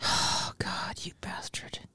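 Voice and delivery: hushed mock curse; whispering. Real, not acted. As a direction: breathe it out unvoiced.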